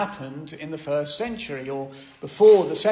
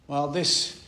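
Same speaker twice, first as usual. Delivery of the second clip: ordinary delivery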